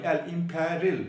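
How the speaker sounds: ordinary talk, nothing marked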